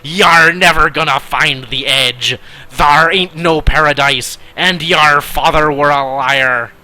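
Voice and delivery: put-on pirate accent. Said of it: "oh, this accent".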